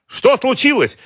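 very high, loud alarmed cry; shouted question. A narrator's reading. Boss calling into the corridor, shouted question. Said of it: "крикнул Георгий".

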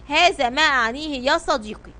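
plain reading voice

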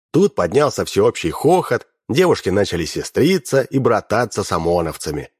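ordinary delivery, nothing marked

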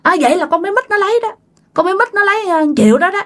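high, fast retold yell at the phone; yelling accusation. Uncle yelling over the phone that Mít took it. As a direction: blurt out the accusation in a high rush. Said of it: "cậu ba mới gào lên".

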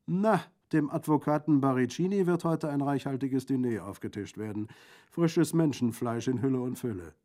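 loud, lively gallows humour; jovial bandit, mocking. Bandit jokes to the lieutenant about the kill, gleeful. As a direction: relish the grim joke at full voice.

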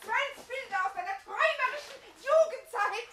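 high, loud, laughing taunt; shrieking with mocking laughter. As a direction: tease him wildly while laughing. Mocking him while laughing.

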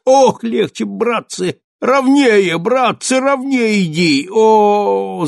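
high, loud pained moans; wounded groaning. Acted. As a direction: groan at every jolt of the stretcher. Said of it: "застонал раненый".

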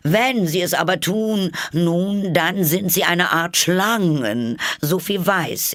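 high, slow bird voice, distrustful; see through her, slowly; high pigeon voice, accusing; suspicious pigeon. A narrator's reading. Pigeon draws conclusion.

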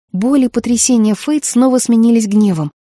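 ordinary delivery

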